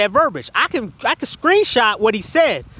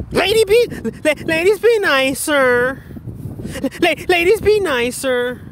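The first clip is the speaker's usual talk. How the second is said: drawn-out, high mocking plea; exaggerated imitation of someone begging; 6 semitones higher, slower than usual. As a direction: stutter into it, then stretch it out mockingly. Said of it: "Begging them and shit to be nice".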